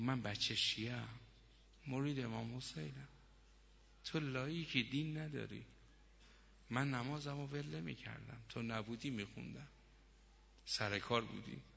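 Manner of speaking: very soft, subdued voice; hushed confession. Acted. Wife confessing her hidden faith to husband, hushed. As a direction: confide it softly, barely above a hush.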